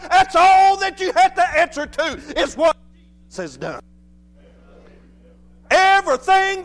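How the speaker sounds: high, impassioned pulpit delivery; full-force preaching. Real, not acted. Preacher rejoicing to the congregation in forgiveness, full-force preaching. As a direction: preach it high and urgent, driving on.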